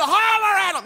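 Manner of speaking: high, loud cowboy yell; shouted order. Acted. Cowboy urges the boy to holler, shouting. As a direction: yell across the herd. Cowboy yelling over the cattle.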